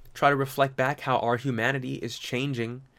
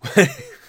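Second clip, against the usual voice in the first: sudden loud high laugh; real burst of laughter; 9 dB louder than usual. Real, not acted. Laughs at robot punchline.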